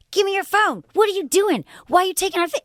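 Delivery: high-pitched imitation of an angry girl; mock confrontation. Girl confronts stranger filming the fight, mock angry demands.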